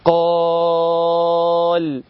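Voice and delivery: قال with the long alef drawn out, chanted; stretched vowel. Shown as a demonstration. Teacher demonstrating the long alef to students, chanted. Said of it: "أقدر أمدها أقول إيه".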